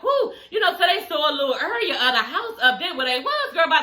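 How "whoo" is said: high, breathless whoo after the stairs; imitating them puffing at the top of the steps; mock winded whoo. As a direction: blow out a tired whoo. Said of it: "they both was like".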